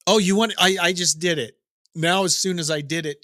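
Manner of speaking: ordinary talk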